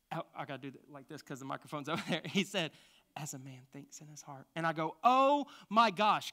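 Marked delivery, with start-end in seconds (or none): 3.2-4.5 hushed, very soft, almost breathed; a soft divine whisper in his ear; whispered scripture. Retold or imitated. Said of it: "God whispered to me". 5.0-6.3 stunned realization. Retold or imitated. Sudden realization.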